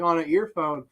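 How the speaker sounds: ordinary talk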